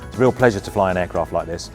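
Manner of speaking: ordinary talk, nothing marked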